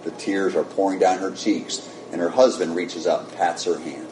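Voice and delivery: ordinary delivery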